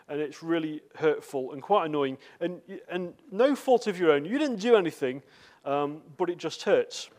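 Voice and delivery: ordinary delivery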